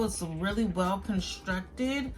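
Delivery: ordinary delivery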